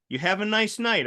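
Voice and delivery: lightly voiced child saying a sweet goodnight; little child. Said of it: "I see this little human".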